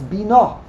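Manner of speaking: "bina" stressed on the final NA; stress on last syllable. Shown as a demonstration. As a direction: land on the end of "bina": bi-NA. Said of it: "Ordinarily, it's milah ra".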